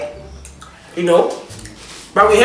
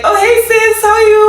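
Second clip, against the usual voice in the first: high singsong imitation of a gushing girl; mock her sweet sing-song hello, pitched way up; sugary stranger greeting; 13 semitones higher, 4 dB louder than usual.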